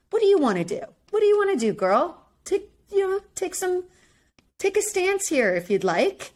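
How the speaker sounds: high, exasperated coaxing; urging Jill. Retold or imitated. Host retelling her own plea to Jill, high exasperated urging.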